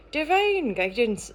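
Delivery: put-on uncertain Irish accent. Reader playing with the words for divine guidance, tentative Irish accent. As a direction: try on a bit of Irish, drawn out. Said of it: "Is that a bit of an Irish accent?".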